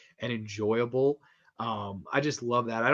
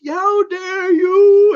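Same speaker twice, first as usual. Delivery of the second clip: much higher, much louder, very slow wail; over-the-top outraged shout; 15 dB louder, much slower than usual. Retold or imitated. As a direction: ham up a scandalised priest, drawing it out. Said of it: "the most over the top".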